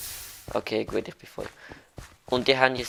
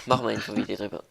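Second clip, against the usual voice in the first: ordinary talk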